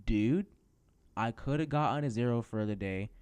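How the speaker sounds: loud exasperated outburst. Real, not acted. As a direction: vent the outrage loudly. Outraged about grades.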